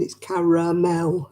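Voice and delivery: caramel with three clear syllables, ka-ra-mel; British caramel. Shown as a demonstration. Correcting Americans on how to say caramel. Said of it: "not caramel".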